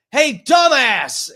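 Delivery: high, loud shout across the yard; yelling at him. Retold or imitated. Homeowner yelling at the man behind the tree, loud shout. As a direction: call him out at full voice.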